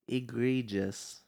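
Egregious said slowly and clearly, every syllable drawn out; slow deliberate word. Shown as a demonstration. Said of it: "Here's my first word".

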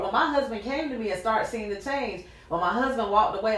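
usual speaking voice

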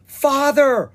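high, loud astonished cry; cried out. Acted. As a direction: cry out to the father you found. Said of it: "Oscar cried".

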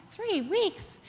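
high, incredulous "Three weeks?"; imitating a patient stunned by a three-week wait; mock shocked question. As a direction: sell the disbelief at the wait. Shocked reaction to long wait.

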